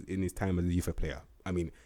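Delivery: ordinary talk, nothing marked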